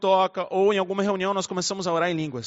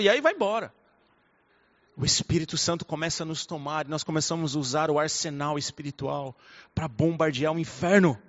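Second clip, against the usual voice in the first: usual speaking voice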